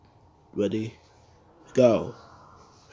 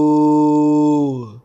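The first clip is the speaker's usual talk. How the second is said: long ooh; 3 semitones higher, 10 dB louder than usual. Real, not acted. Reacting to a mistake in game.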